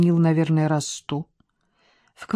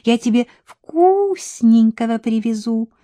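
much higher, louder, eager coaxing; eager wheedling; 8 semitones higher, 5 dB louder than usual. A narrator's reading. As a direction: wheedle him excitedly. Milka urging on phone. (задорно и высоко упрашивает)

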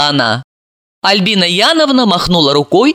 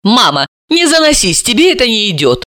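mild, higher-pitched protest; sincere indignation; 6 semitones higher than usual. A narrator's reading. Daughter challenging her mother, mildly indignant. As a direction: object with a touch of indignation. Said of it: "Серафима искренне возмутилась".

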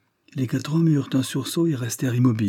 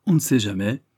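plain reading voice

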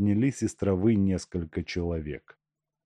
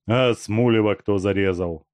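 plain reading voice

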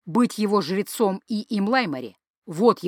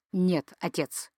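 slow, distinct, much softer; crisp and clear; 2 semitones lower, 8 dB quieter, slower than usual. A narrator's reading. Son answering his father, slow, crisp and soft. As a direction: lay each word down clearly. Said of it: "чётко произнёс юноша".

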